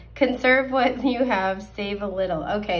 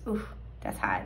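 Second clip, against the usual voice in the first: whispering; 12 semitones lower, 7 dB quieter than usual. Real, not acted. Excited reaction to card meaning.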